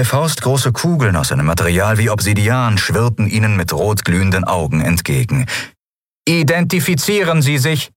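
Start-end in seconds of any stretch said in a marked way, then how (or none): 6.3-7.9 rasping machine guards. Acted. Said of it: "schnarrten sie".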